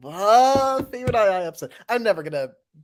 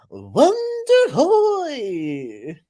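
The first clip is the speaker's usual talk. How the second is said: stretched cry; 10 semitones higher, 5 dB louder, much slower than usual. Pretend. Candle bit, stretched cry.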